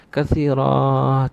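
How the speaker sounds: "Katsira" stretched very long on its last syllable; drawn-out KATSIRA. Shown as a demonstration. Preacher stresses praise beyond words, stretching "Katsira". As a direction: hold the end of "Katsira" very long. Dwells on word meaning endlessly much.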